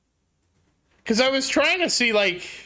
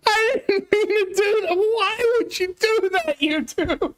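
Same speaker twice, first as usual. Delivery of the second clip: whiny distress; 11 semitones higher than usual. Real, not acted. Streamer to chat after a misclick, near tears. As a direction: wail it like a real mistake hurts.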